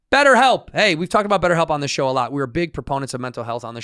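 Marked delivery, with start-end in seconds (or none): 0.1-0.7 hyped announcer. Acted. Podcast host launches the sponsor read, loud and high. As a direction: kick off the ad with a big announcement.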